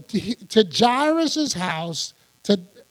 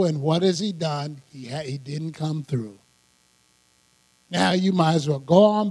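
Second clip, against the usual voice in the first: discouraging voice in the ear, sinking lower; devil in his ear; 6 semitones lower than usual. Retold or imitated. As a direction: imitate the devil planting doubt. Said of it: "devil was saying this in his ear".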